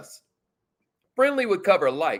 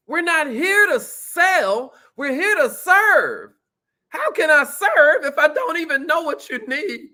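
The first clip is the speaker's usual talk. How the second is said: fired up; 7 semitones higher, 4 dB louder, slower than usual. Real, not acted. Preaching his sales motto.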